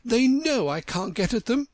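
pitched high in a flustered temper; agitated panting. A narrator's reading. Tiger Lily trembling with excitement to Alice, agitated. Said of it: "it panted".

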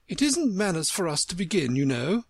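high storybook flower voice, mildly reproving; a hint of a high-voiced talking flower; lightly voiced prim Rose. A narrator's reading. The Rose explaining manners to Alice, lightly reproving.

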